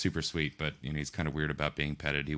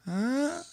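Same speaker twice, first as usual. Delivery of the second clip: very high, drawn-out cat yowl; imitating cat yowl. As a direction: wail like an old cat at four in the morning. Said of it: "and she's like".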